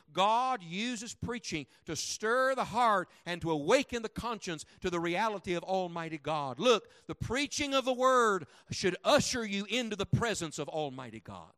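loud, rising pulpit cadence, hammering each line; fiery revival preacher; preaching at full force. Acted. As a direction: preach it hard to the congregation.

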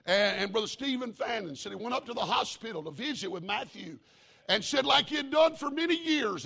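loud, high revival storytelling; full-force preaching. Acted. Preacher telling the church the news, loud and high. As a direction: build it back up to a shout.

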